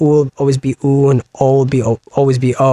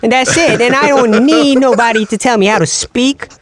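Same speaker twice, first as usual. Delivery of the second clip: high, hammed-up tough guy with odd Irish tinge; pitched-up, defiant wise-guy caricature; Joey Wheeler; 6 semitones higher than usual. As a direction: refuse stubbornly in cartoon dialect. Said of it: "like Joey Wheeler with some kind".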